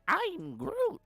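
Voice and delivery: tiny high-pitched little-creature voice; Groot. Retold or imitated. Fan imitating the tiny tree guy, squeaky-high voice. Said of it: "when he was like that".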